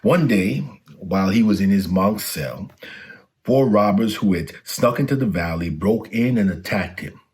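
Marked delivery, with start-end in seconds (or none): none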